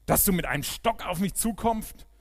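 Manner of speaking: höhnischer lauter Ruf, deutlich höher; brüll ihn verächtlich an; gerufen. A narrator's reading. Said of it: "rief er David zu". (spöttisch laut gerufen)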